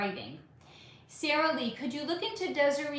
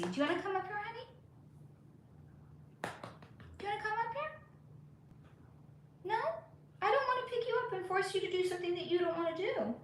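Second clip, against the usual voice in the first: much higher, softer pet-talk coo; 8 semitones higher, 6 dB quieter, much faster than usual. Real, not acted. Owner coaxing her cat onto her lap, much higher soft baby talk. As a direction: coax the kitty up onto your lap sweetly.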